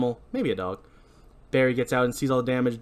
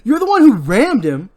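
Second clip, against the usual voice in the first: loud, high, heated blame; raised-voice retort; angry accusation; 10 semitones higher, 11 dB louder than usual. Acted. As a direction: throw the blame back loudly. Barry blames Ray.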